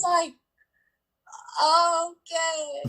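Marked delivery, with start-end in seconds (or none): 1.6-2.8 high, drawn-out, unconvinced reply; retold reluctant acceptance. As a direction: act out swallowing upsetting news, pitched up. Retold stunned acceptance before crying.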